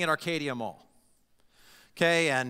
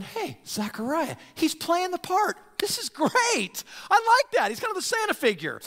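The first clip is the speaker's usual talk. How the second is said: much higher, enthusiastic voice; excited bystander; 12 semitones higher, 3 dB louder than usual. Retold or imitated. Onlookers watching Jesus on a donkey, gushing much higher. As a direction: gush like a fan enjoying the show. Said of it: "some people ... are going".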